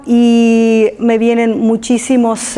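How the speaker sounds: ordinary talk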